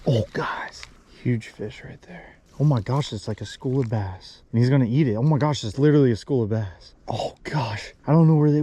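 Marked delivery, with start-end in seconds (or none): 0.1-2.4 hushed whisper to the camera. Real, not acted. Spotting fish, whispering to viewers. 7.1-7.9 soft unvoiced whisper. Real, not acted. Whispering while spotting fish.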